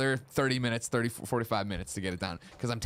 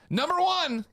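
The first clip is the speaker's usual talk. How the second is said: high, loud announcer call; loud high announcer count; 4 dB louder, slower than usual. Acted. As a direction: call out the first item. Announcing the first item.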